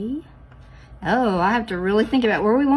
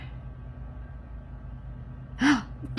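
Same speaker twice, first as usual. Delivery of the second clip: sudden gasp; 5 semitones higher, 7 dB quieter than usual. Real, not acted. Realizes her mistake.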